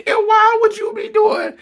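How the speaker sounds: high, laugh-choked question; words squeezed out through helpless laughter; laughing hard. Real, not acted. Host asking why the couple would do that, cracking up.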